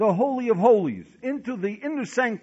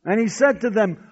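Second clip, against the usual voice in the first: ordinary talk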